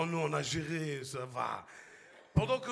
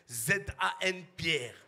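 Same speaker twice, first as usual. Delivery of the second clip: spelled out slowly; 5 semitones lower, much slower than usual. Retold or imitated. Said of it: "tu zozotes".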